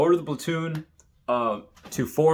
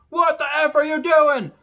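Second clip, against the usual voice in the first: high, loud mock scream; 6 dB louder than usual. Drill instructor screams at rock-throwing recruit, high loud yell.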